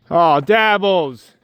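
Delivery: slow, drawn-out disappointed sigh of a name; mock-weary plea to a stubborn bird; exasperated aw. Real, not acted. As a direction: let the name sag with fond exasperation. Goose refuses to come out.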